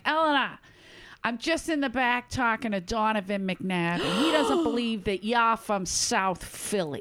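imitated Boston waitress; Boston accent. Waitress rushing into the kitchen with news, Boston accent. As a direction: put on a coworker's Boston accent. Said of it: "you do the Boston accent really well".

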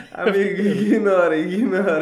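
loud, laughing dismissal. Real, not acted. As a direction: brush it off through laughter. Laughing at a strange clip.

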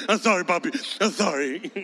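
mock tearful apology to a parent; sniffly, pitiful little apology; imitated sniffling child. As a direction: act a kid choking back sobs. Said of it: "suck up all your boogers".

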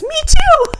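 sudden high, loud squeal of agreement; eager blurt. Retold or imitated. Penny eagerly claiming to be a preemie too, high squeal. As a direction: blurt it out, jumping at the chance. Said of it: "she immediately goes".

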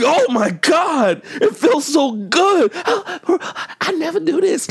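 high slow hammed-up moaning. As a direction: drag it out like a fake moan.

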